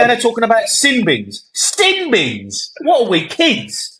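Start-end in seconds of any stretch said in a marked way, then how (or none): 1.5-4.0 incredulous rant. Real, not acted. Ranting pundit mocking rule.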